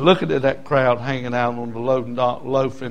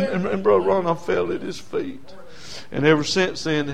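normal talking voice

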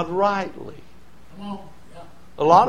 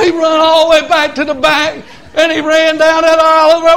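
loud, high revival storytelling; fired-up country preacher; full-force preaching; 10 semitones higher, 7 dB louder than usual. Real, not acted. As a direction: holler the tale out, pitched high.

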